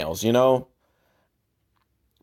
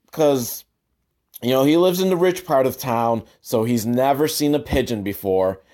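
normal talking voice

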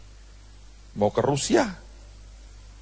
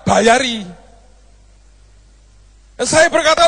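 ordinary delivery

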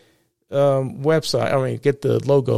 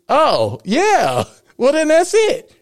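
mock realization; 13 semitones higher, 5 dB louder, slower than usual. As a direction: voice jumps much higher for an acted dawning "oh yeah". Retells his own reaction to logo.

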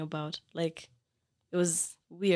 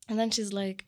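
usual speaking voice

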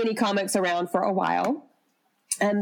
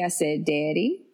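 Southern-accent "daddy" with a long, low first vowel; 4 semitones lower than usual. Shown as a demonstration. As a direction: say daddy the way a Southern girl would. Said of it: "I really did sound really Southern".

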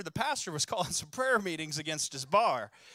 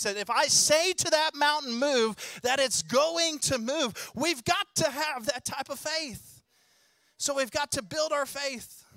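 raised, urgent preacher's call; fervent preaching; 6 semitones higher, 5 dB louder than usual. Real, not acted. Preacher rousing the church to believe, loud and fervent. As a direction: stir the congregation's faith, loud and high.

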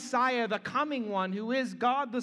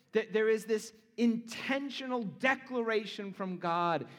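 ordinary talk

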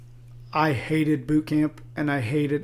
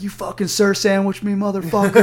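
drill sergeant; 4 semitones higher, 5 dB louder than usual. Retold or imitated. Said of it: "And they're like".

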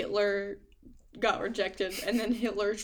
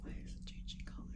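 whispering; 13 dB quieter, faster than usual. Real, not acted. Sudden whispering.

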